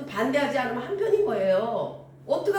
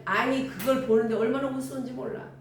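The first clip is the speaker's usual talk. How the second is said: ordinary delivery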